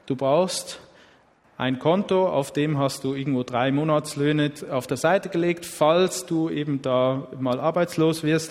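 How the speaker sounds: ordinary talk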